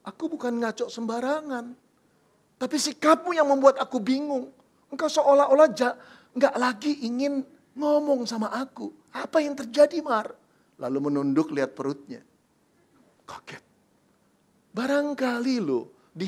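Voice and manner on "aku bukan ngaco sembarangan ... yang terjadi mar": higher and rising louder; agitated Joseph. Acted. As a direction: push harder for the truth. Joseph pressing, growing upset.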